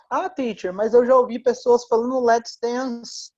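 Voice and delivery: a hint of a puzzled pupil piping up; objecting student.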